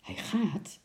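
hushed, whispery amazement; amazed. A narrator's reading. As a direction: half-whisper it in disbelief. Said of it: "zei oom Nijltje verbaasd".

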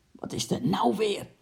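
mildly exasperated husband; grumbling. A narrator's reading. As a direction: grumble it, fed up. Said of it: "bromde de meneer".

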